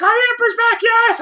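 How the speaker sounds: high, gleeful squeal; excited fan. Retold or imitated. Podcaster reliving his fanboy joy, squealing high. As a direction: cheer like a kid whose villain came back. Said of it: "I was excited".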